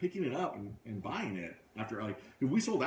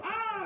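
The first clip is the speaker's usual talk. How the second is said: high, louder cartoon yelp of pain; mock scream; 4 dB louder than usual. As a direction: squeal like a tiny toy losing its hand. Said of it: "his little Lego hand go".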